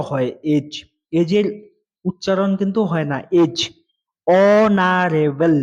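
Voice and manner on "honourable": অনারেবল said very slowly, starting on the vowel with no h; slow classroom model of অনারেবল, h left silent. Shown as a demonstration. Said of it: "এর উচ্চারণ হচ্ছে অনারেবল".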